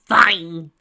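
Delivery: loud exasperated groan of a word; groaning fine. A narrator's reading. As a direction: give up with a loud huff. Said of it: "Tracy groaned".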